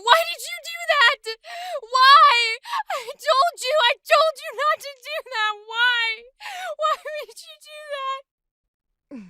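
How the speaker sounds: high, loud anguished wailing, slowing at the end; devastated summoner. Acted. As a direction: sob the accusation out at full force. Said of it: "So let's try it".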